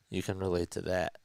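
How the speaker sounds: usual speaking voice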